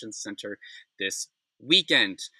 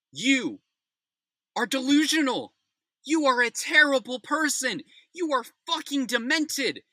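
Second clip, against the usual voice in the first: furious tirade; 9 semitones higher than usual. Real, not acted. Ranting straight at Tom Homan, enraged. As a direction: tear into him, voice straining.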